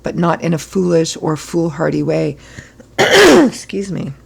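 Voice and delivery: hoarse, rough throat. Real, not acted. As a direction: push through a hoarse throat. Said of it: "just got hoarse".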